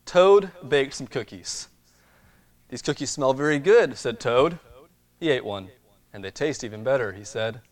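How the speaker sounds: lively storybook reading; reading to children. Acted. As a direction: read it like a bedtime picture book. Reads storybook to congregation.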